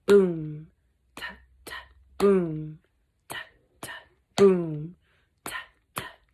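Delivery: low boom then whispered taps; drumbeat. Acted. Narrator imitating the Mouse Council drum. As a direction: sound out the drum rhythm.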